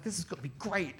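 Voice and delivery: high, squeaky hushed excitement; freaking out. Retold or imitated. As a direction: squeal it under your breath, giddy. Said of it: "he's, like, freaking out".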